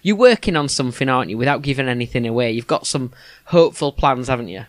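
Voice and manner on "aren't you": normal talking voice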